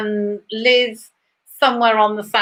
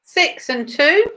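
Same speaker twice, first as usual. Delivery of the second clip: rising bingo number call; bingo caller; slower than usual. Acted.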